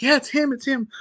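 high, quick cry of identification; accusing lady. Retold or imitated. Lady pointing out the man to townspeople, acted accusation. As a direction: pitch it up as an accusing woman.

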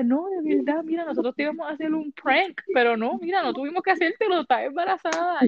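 high insistent coworker voice. Retold or imitated. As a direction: pitch up and insist it's true. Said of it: "Y ella".

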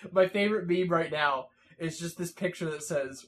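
ordinary delivery

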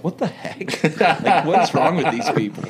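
high, laughing "what the heck"; laughing disbelief. Retold or imitated. Missionary on a bus laughing in disbelief at the movie. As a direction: break into laughter at the dark film.

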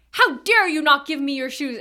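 loud, indignant mock outburst; really mad. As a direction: act out an outraged demand. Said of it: "he's getting really mad at me".

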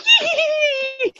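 high shrieking witch cackle. Pretend. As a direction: let out a wild witch cackle. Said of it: "I'll be like".

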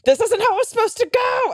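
high-pitched mock tantrum; hammed-up wail of frustration; control-freak meltdown. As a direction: imitate losing it in a whiny protest. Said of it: "having, like, a control issue".

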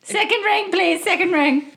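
high insistent request; high put-on player voice; character voice. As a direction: ask politely, pointedly.

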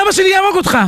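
high-pitched outraged protest; indignant threat. Acted. Tied-up prince threatens his teacher, indignant.

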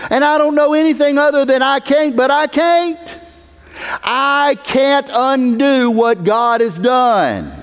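high, sustained emphatic pulpit voice; impassioned preaching. Real, not acted. Preacher defending eternal security, impassioned. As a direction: insist on it, pitched high throughout.

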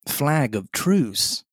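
slow, drawn-out call. A narrator's reading. Silver crying out to the captain, calling. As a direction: call it out slowly to the stockade. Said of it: "cried Silver".

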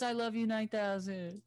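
slow, high robotic line; slow high mock robot; robot voice quote. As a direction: recite it like a robot. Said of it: "That's why he says".

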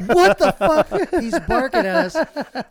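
high, loud, slowed panic; retold fright as the pig charges; panicked yell. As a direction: cry out in terror.

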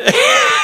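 high, loud cackling laugh; imitated cackle. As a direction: cackle like a dusty hunchback. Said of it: "he kinda laughs something like that".

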